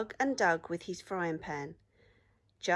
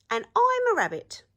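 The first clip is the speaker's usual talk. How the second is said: high, loud, bouncy rabbit voice; 10 dB louder than usual. A narrator's reading. Rabbit answering the troll from the bridge, high and bright. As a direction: chirp it up high like a cheeky rabbit.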